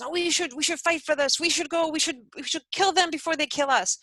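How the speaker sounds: high, insistent rallying voice; agitated urging. Retold or imitated. Somebody urging Gandhi's crowd to fight back, agitated. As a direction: act out a hothead pleading to fight.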